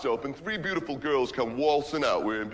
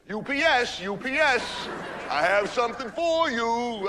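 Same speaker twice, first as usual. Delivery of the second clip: higher, mock-suggestive delivery man; porno delivery guy; 7 semitones higher than usual. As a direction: play the cheesy UPS guy. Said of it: "Guy outside goes".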